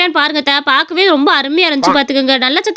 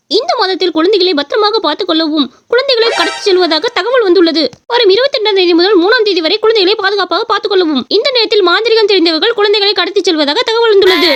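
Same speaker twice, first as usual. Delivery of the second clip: formal announcer, higher and steady; news read; 4 semitones higher than usual. Acted. Reel announces child kidnappings, formal news-reader delivery. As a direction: read the warning like a TV bulletin.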